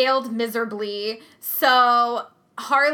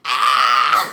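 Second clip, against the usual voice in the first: put-on low loud laughing yelp of a fussing baby; mock baby shriek; 11 semitones lower, 8 dB louder than usual. Mom imitating her baby's shriek while laughing. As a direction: squawk like a baby refusing the breast. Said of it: "she's like".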